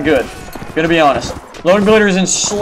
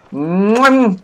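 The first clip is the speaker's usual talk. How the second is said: double air kiss. Pretend. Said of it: "Let me kiss you on the cheeks".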